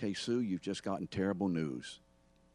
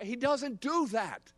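very high, raised-voice protest; emphatic denial; 4 dB louder than usual. Real, not acted. Preacher knocking down his parody of Jesus, emphatic denial. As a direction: cry out the denial at the top of your range.

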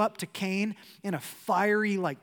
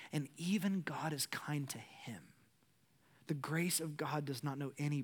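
hushed whisper of awe; 2 semitones lower, 7 dB quieter than usual. Real, not acted. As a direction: whisper it, let the grace land. Hushed point about God's grace.

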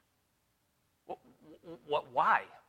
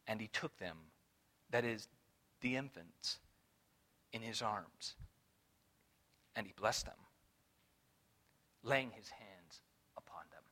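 tender reading; 7 semitones lower, 9 dB quieter than usual. Acted. Scripture read tenderly.

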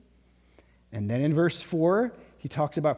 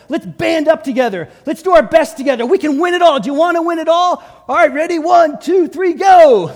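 building to a shouted, much higher locker-room rally; coach's pep talk; 8 semitones higher, 10 dB louder than usual. Retold or imitated. Coach firing up the team before the season, rising to a shout. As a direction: pump the team up until you're yelling. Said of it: "the coach kinda gets down with everybody. It's like".

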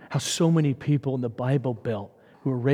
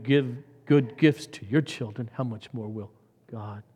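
usual speaking voice

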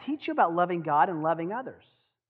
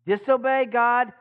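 put-on simple children's lesson; 5 semitones higher, 6 dB louder than usual. Shown as a demonstration. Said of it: "that simple lesson? Watch this".

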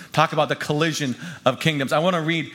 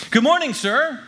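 bright British-style greeting; cheerful, polished British; 11 semitones higher, 4 dB louder than usual. A narrator's reading. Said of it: "as cheerfully as possible and in my most polished British accent".

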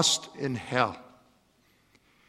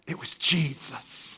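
breathy hushed reveal; awed whisper of the name; 3 semitones lower, 9 dB quieter than usual. Acted. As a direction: whisper it like a secret.

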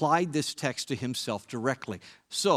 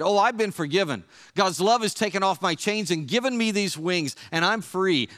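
louder and higher, triumphant; strong, joyful voice; 6 semitones higher, 5 dB louder than usual. A narrator's reading. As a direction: proclaim it like a freed prisoner. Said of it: "his voice grew strong".

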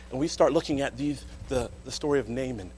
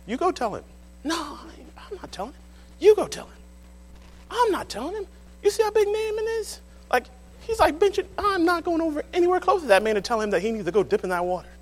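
higher, squeaky frightened back-and-forth; nervous servants squabbling; 11 semitones higher, 5 dB louder than usual. Retold or imitated. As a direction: play scared servants passing the buck. Servants bickering, comic scene.

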